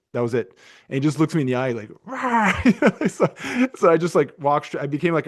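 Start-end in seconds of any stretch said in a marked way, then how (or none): 2.1-3.0 high mock roar of defiance, laughing; retold roar. Father retelling son's defiant yell at him: high mock roar.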